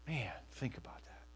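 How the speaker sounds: a hushed whispered aside. Real, not acted. Pastor urging the congregation to reflect, whispered. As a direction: breathe it as a secret.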